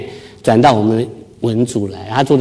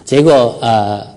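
ordinary talk, nothing marked

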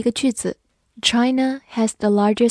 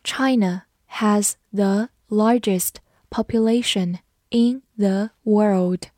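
China has the largest population read slowly, word by word; slower than usual. Shown as a demonstration. Teacher reading slowly so students repeat after her. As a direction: read it slowly for learners to follow. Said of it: "跟着我慢读一遍".

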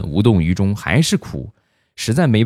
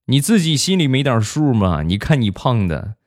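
higher-pitched scolding retorts, imitating mom; eye-rolling mom, voice pitched up; exasperated mom; 6 semitones higher than usual. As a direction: play a mother throwing the blame back, question after question. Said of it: "我妈翻了翻白眼儿".

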